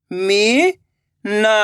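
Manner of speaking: very slow, each syllable drawn out; slow syllable reading. Shown as a demonstration. Teacher reading to children, drawing out every syllable slowly. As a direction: spell the word out slowly, syllable by syllable. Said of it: "तो मिलके बन जाएगा".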